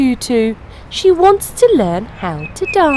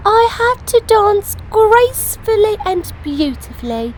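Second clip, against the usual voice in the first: Peppa voice; 11 semitones higher than usual. Acted. Peppa voiced in read story.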